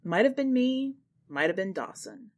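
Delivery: ordinary delivery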